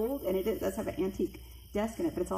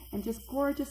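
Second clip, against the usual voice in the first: ordinary talk, nothing marked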